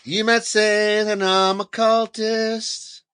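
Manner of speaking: slow, high, menacing cartoon villain; mock cultist. Host plays a menacing cultist for laughs, hammed-up. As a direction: threaten them slowly like a cult villain.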